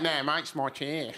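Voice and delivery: slow, drawn-out Australian imitation; mimicked Aussie accent. Arguing back over a chair, copied Aussie accent. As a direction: copy his Australian accent right back at him. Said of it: "I started doing his accent back".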